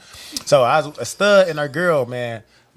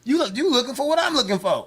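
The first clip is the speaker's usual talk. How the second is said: laughing; 8 semitones higher than usual. Real, not acted. Laughing mid-joke.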